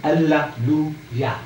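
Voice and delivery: Hallelujah slowly pulled apart into Alla-lu-ya; slow syllable breakdown. Shown as a demonstration. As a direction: say Hallelujah in separate drawn-out chunks. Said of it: "You hear the words?".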